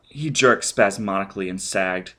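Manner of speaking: ordinary delivery, nothing marked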